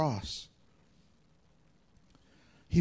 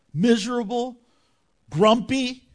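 ordinary delivery

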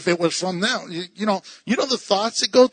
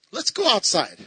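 ordinary talk

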